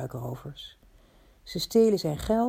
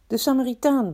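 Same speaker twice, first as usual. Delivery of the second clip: raised, higher crowd shout; crowd's roar; 6 semitones higher, 5 dB louder than usual. A narrator's reading. Crowd answers the teacher's question, a narrated roar. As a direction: call it out louder, like a crowd answering. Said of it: "brulde de menigte".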